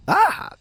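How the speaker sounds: sharp high yelp of pain; startled yelp. Acted. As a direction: cry out as something lands on you. Gang member lands on train.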